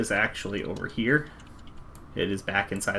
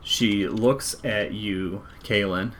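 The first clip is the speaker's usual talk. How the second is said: normal talking voice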